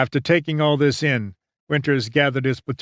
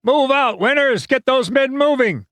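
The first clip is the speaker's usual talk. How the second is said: high, forceful shouted order; shouting; 11 semitones higher, 4 dB louder than usual. A narrator's reading. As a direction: shout it from behind the line. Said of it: "he could hear Strayer shouting".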